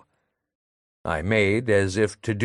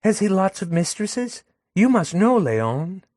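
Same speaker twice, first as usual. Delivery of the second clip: put-on higher, coaxing young woman; Madelon fishing; 9 semitones higher than usual. Madelon fishing Léon for gossip, higher coaxing voice. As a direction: wheedle the answer out of him.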